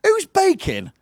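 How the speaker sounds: high-pitched disbelief; incredulous question. Retold or imitated. Host baffled by late-morning baking request, high incredulous voice.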